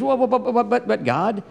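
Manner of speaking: high-pitched, stammered "buts"; mock sputtering objection. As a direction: stumble over your excuses to God. Moses sputtering objections to God.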